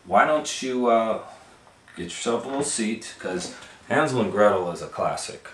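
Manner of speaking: lively, loud storyteller voice for a little listener; animated read-aloud for a kid; reading to a child. Acted.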